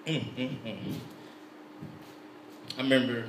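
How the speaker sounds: normal talking voice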